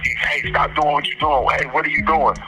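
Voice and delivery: plain reading voice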